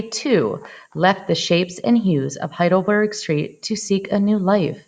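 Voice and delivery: usual speaking voice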